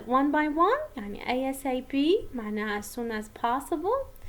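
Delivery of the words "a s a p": A S A P said as four separate letter names. Shown as a demonstration. Teacher shows students an initialism read one by one, letter by letter. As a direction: spell out A S A P one letter at a time. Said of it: "letter by one".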